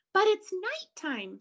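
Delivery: high, squeaky little bat protest; squeak. Acted. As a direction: squeak it like a worried young bat. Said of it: "Stella Luna squeaked".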